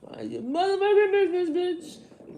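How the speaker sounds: very loud, high-pitched comic yell; hammed-up high holler; Kevin Hart. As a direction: shout it like a worked-up stand-up comedian. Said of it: "Like Kevin Hart".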